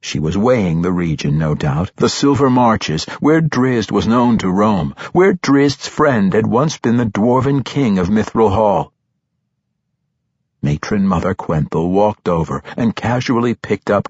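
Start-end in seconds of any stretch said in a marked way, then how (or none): none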